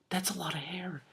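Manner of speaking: soft unvoiced aside; whispered aside. Real, not acted. Reader commenting on the long hair, whispered. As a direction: whisper it to yourself.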